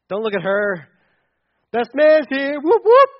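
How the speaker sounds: loud, high showboating best man, ending in whoops; hammed-up pushy best man. Best man barging in at the wedding, loud cocky crowing. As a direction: shove the groom aside and crow at the crowd. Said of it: "the best man's like".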